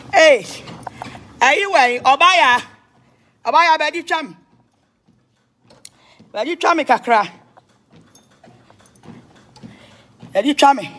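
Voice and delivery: high, loud shouted call. Real, not acted. Vlogger calling someone over, loud high holler.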